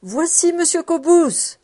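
high, loud announcing call; crying out. A narrator's reading. Old servant announcing her master from the vestibule, crying out high. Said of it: "la vieille Catel criait déjà dans le vestibule".

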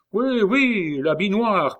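high, loud, musing exclamation; talking to himself. A narrator's reading. Lambert goes on talking to himself, musing excitedly. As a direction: muse aloud, pitched right up. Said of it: "en se parlant à lui-même".

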